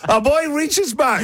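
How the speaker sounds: high-pitched gleeful cheer; squealing mock excitement for a returning hero; hyped mock announcement. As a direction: crow it like a thrilled fan.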